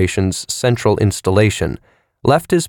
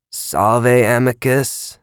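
intoned; 4 semitones higher, much slower than usual. Acted. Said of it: "Plaut intoned".

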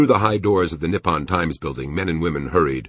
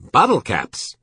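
high, loud outburst; sudden exclamation; 8 semitones higher, 5 dB louder, slower than usual. A narrator's reading. Shopkeeper exclaiming without warning to the officer, high and loud. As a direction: exclaim it out of the blue. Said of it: "Chilton had exclaimed without warning".

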